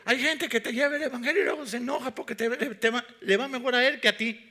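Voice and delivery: fast, high, loud fiery preaching; preaching at full force. Real, not acted. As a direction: hammer the point home to the congregation. (predicación fervorosa, rápida y fuerte)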